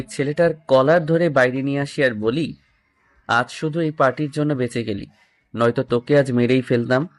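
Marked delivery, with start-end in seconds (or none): none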